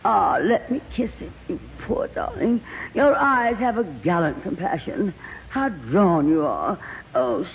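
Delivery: high, slow, swooning theatrical gush; gushing glamorous actress. Acted. Lorraine fussing over Sheridan's tortured face, gushing and high. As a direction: coo over him like a diva.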